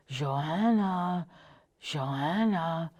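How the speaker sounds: a hint of a gravelly voice calling down the stairs; lightly voiced old neighbour calling a name; gravelly old woman calling. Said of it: "a familiar gravelly voice from above".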